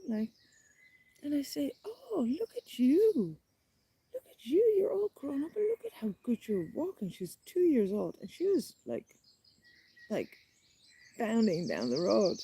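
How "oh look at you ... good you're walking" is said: put-on cooing at a toddler. Storyteller praises two-year-old's walking, high cooing voice. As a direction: gush in high baby-talk.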